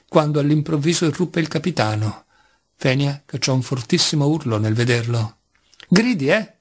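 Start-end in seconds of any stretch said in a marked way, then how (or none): 5.9-6.6 sudden higher yelled taunt; shrieking. Acted. Mitya bursting into the kitchen at Fenia, higher yelled taunt. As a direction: snap at the screaming maid. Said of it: "strillò Mitya".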